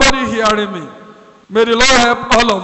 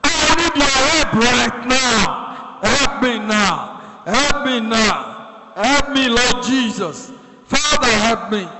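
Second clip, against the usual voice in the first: slow, urgent plea for help; slow pleading prayer; desperate plea. Shown as a demonstration. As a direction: beg the Lord for help right now.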